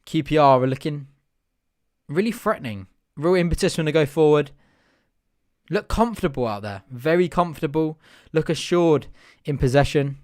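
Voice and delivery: usual speaking voice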